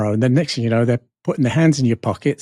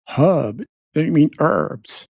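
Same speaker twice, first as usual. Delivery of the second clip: American correcting; 3 semitones higher, slower than usual. Retold or imitated. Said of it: "the guy goes".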